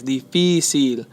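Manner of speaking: difícil slowly, FÍ drawn out; stress second syllable. Shown as a demonstration. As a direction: stretch the middle syllable of difícil. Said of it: "it's on the segundo".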